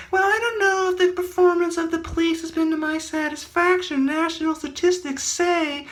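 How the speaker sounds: high pompous official voice; mock a politician with a high voice; high put-on politician voice. Storyteller voicing a pompous politician.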